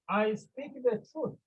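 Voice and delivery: emphatic assertion. Acted. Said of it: "he confirms".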